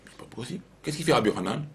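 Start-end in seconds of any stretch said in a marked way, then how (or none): 0.1-0.6 whispered disbelief, much softer; awed whisper. A narrator's reading. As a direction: breathe it out in amazement. Amazed at the story.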